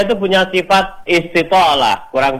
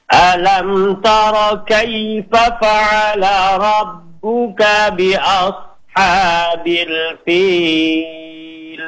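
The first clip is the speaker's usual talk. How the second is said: slow melodic tartil chant; teacher modelling tajwid recitation; Quran recitation; 3 semitones higher, much slower than usual. Shown as a demonstration. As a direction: recite it measured and sung.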